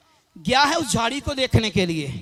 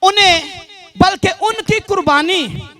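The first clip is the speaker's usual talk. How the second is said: raised, declamatory sermon delivery; emphatic preaching; 3 semitones higher, 5 dB louder, slower than usual. Real, not acted. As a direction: proclaim it to the congregation in measured phrases. Proclaims a prophecy from scripture. (जोशीला उपदेश, बुलंद आवाज़ में)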